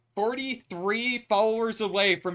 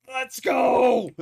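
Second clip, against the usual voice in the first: loud hype shout; frat bro; 6 semitones higher, 5 dB louder than usual. Real, not acted. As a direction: cheer it out like a bro. Hype cheer to chat.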